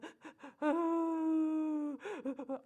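high whiny fake cry; quick demo of a small child's upset whine; imitated child whimper. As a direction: mimic a fussing child's whimper. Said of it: "all of the different sounds that they make".

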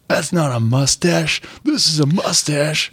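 drawn-out tough-guy one-upmanship; biker tough guy. Acted. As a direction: stretch it out like a showboating biker. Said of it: "the guy's like".

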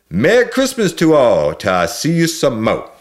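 high, loud Cajun holiday shout. Acted. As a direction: bellow it in Cajun accent as the sleigh leaves. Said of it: "I hear him shout out loud".

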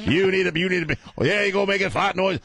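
high-pitched, chanting sermon rant; hammed-up preacher tirade; radio preacher. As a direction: scold a sinner, building to revival fervor. Preacher impression scolding the co-host.

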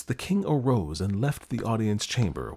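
usual speaking voice

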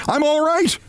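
high yell to reassure; reassuring yell. Acted. Cousin yelling up to the mother to reassure her. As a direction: call it out so she stops worrying. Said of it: "Briggs yelled to reassure her".

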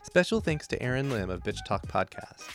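smooth scripted podcast intro; podcast host read. A narrator's reading.

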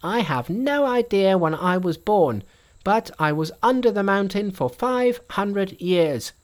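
high, bright monkey king voice. A narrator's reading.

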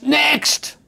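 high sharp call of Next; a teller yelling next at the queue; screamed "Next!". Retold or imitated. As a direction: bark it out across the hall like a bored teller. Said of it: "somebody on the right screams".

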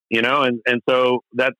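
usual speaking voice

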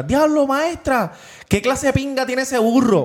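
high, slow, wailing mock yell; retold kid's yelp to the teacher, hammed up and drawn out. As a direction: shout it like a shocked little kid. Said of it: "viene y grita a la maestra".

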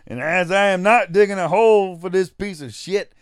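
loud, high backwoods Southern rant; hammed-up complaining Southern yokel; Gomer Pyle. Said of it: "that's why I'm doing the Gomer Pyle".

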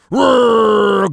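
put-on kids roaring. Retelling how his five-year-olds roared back, a playful shouted roar.

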